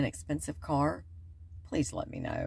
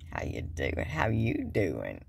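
much lower pickup line; low mock flirting; 12 semitones lower, slower than usual. Repeating the flirty line to truck man, pitched low. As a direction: drop it low for a playful come-on. Same come-on, dropped low.

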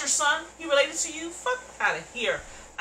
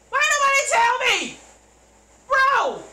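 high loud exasperated shout; heated outburst; 13 semitones higher, 10 dB louder than usual. Real, not acted. Viewer yelling at the show, loud and heated. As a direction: yell it at the screen, heated. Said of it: "I'm heated right now".

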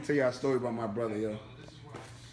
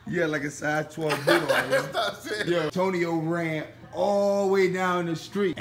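laughing; 4 semitones higher, 3 dB louder, slower than usual. Real, not acted. Cracking up retelling story.

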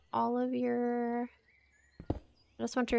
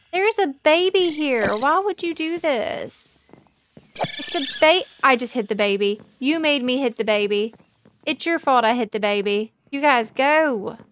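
loud anguished outburst; 9 dB louder than usual. Real, not acted. Accidentally hit a baby villager.

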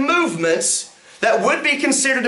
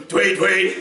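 bird imitation. Shown as a demonstration. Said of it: "birds ... going".

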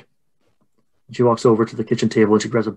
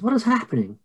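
alarmed mother; 11 semitones higher, 3 dB quieter than usual. Acted. Mary runs up to the scene, high alarmed voice. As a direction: cry out in contained alarm. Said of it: "she's running down towards you".

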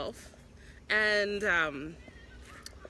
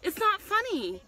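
high-pitched, upset challenge; real rattled outrage; indignant confrontation; 9 semitones higher than usual. Real, not acted. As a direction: confront someone laughing at your injury. Confronting owners laughing at bite.

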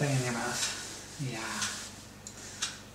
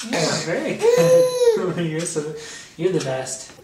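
delighted praise; 9 dB louder, slower than usual. Real, not acted. Dad to baby after good drinking, delighted laughing praise.

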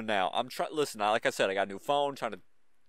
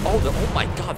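loud, higher, jolted exclamation; real startle; 5 semitones higher, 8 dB louder than usual. Real, not acted. Gamer jolted by people blowing up the cabin, startled outburst. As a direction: blurt it out loud after a scare. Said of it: "that actually really scared me".

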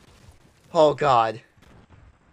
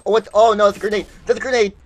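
alarmed yell, high and loud; grenade warning yell; 8 semitones higher, 9 dB louder, faster than usual. Real, not acted. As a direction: shout the grenade warning in panic.

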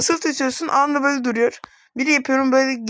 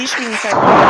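frustrated outburst; 9 dB louder than usual. Real, not acted. Oyun vuruşları saymıyor, sinirleniyor.

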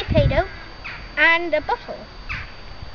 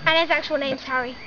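usual speaking voice

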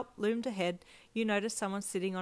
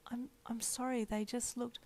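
timid whisper, pitched higher; guilty whisper; 5 semitones higher, 5 dB quieter than usual. A narrator's reading. Daughter caught by dad, apologising in a timid whisper. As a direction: shrink away as you apologise.

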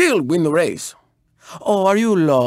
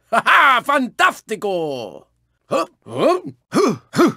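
normal talking voice

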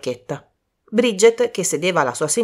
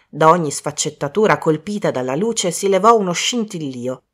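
ordinary delivery

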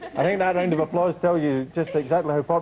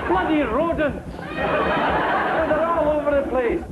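exasperated; 9 semitones higher, much slower than usual. Acted. Annoyed at rodents in the flat.

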